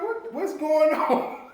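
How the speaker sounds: high, slow baffled voice; mock the puzzled workers high and drawn out; confused workers. Imitating the confused Little Caesars staff, high and slow. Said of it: "they like".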